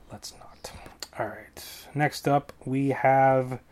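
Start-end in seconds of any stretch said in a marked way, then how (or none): 0.1-0.7 unvoiced whisper, dropping the joke; whispered aside. Real, not acted. Vlogger dropping his own joke, whispered aside. 1.2-1.9 unvoiced quick whisper. Real, not acted. Vlogger whispering himself back on track.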